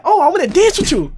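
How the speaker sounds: high, loud excitement; hammed-up keen invitation; imitated eager girl. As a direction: gush it way up high. Retold girl wants to dance.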